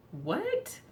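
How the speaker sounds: high disbelieving what; shocked what. Retold or imitated. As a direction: pop the pitch up in disbelief. Her shocked reaction.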